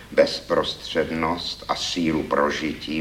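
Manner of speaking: usual speaking voice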